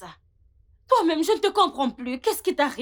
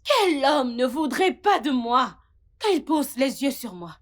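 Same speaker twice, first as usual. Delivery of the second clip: ordinary talk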